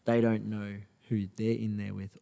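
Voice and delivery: usual speaking voice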